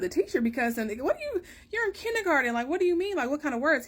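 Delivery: a mother stunned by her kindergartner's news, voice climbing high; shocked mom asking her son what he means, voice high; shocked disbelief. Retold or imitated. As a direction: pitch jumps way up in alarm. Mom shocked at son's words.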